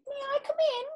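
high, soft little cat voice; polite cat. Acted. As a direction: ask gently as the cat. The cat asking.

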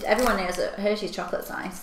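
ordinary delivery

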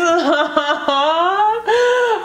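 laughing through tears, high; a high, wet laugh as tears come; teary laugh-cry. Real, not acted. Reader touched by the reading, high teary laugh. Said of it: "I'm gonna cry".

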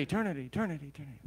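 mimicked echo dying away; fading echo. General's battle cry ringing out, imitated echo fading to a whisper.

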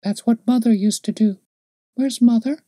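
put-on high child's voice asking; little girl. A narrator's reading. Little girl asks the man where Mother is, high child voice.

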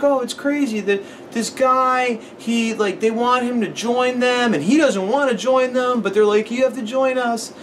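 put-on high, slow, earnest little kid. Retelling how the kid described the movie, in a high slow child voice. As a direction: talk like a small boy explaining a movie.

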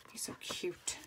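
soft cooing whisper over something cute; tiny adoring whisper; whispered aww. Real, not acted. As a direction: breathe it like a doting aunt.

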